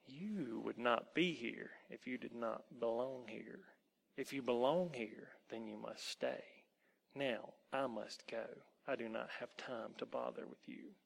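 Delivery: cold, quiet dismissal; wolf woman. Acted. Woman turns the boy away, quiet and unmoved.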